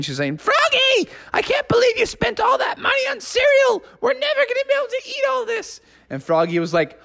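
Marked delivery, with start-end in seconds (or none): 0.4-5.8 a hammed-up furious mother yelling at her son; frantic mom. Mom yelling at Froggy about the cereal money, high mock shout. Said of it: "was going insane".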